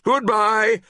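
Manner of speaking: high, loud called goodbye; called farewell. A narrator's reading. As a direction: sing out a last goodbye. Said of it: "they chorused".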